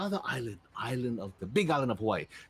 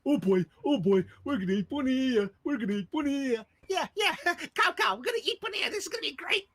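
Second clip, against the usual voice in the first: high, excited shark minion voices; squeaky gleeful sharks; giddy minion sharks; 12 semitones higher, 4 dB louder than usual. Acted. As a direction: cackle with glee about eating Punia.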